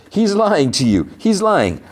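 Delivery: high, falling acted dismissal; mocking exposure. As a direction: pitch it way up to debunk the claim. Retold laughing doctor mocks barber's claim.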